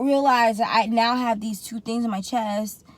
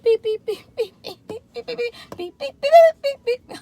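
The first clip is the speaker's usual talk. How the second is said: very high rapid beeps; imitated machine beeping; 14 semitones higher, 5 dB louder than usual. EKG machine checking her heart rate, high frantic beeps. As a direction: squeak like a racing heart monitor. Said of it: "the machine's going beep".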